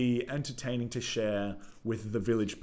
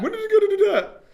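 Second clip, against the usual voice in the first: very high, loud silly voice; hammed-up self-mockery; 13 dB louder than usual. Host mocks own garbled question, loud and silly. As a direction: parrot it back in a silly squawk.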